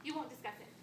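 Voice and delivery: ordinary delivery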